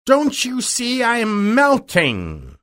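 very high, slow, drawn-out wail; witch's anguished cry. A narrator's reading. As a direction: wail it slowly as a melting witch. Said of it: "cried the witch".

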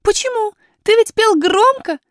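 high-pitched astonished little princess; puzzled, piping question; surprised. A narrator's reading. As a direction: ask in high, girlish surprise. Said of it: "удивилась Айрин".

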